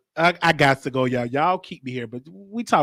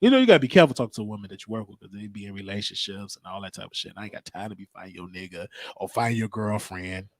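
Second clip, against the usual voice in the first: ordinary talk, nothing marked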